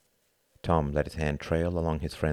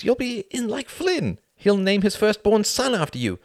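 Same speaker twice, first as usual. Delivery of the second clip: building louder and higher, mock-encouraging; exasperated rant; 8 semitones higher, 4 dB louder than usual. Tom advising his friend on joining the appeasers, exasperated. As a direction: rattle off the advice with rising sarcasm.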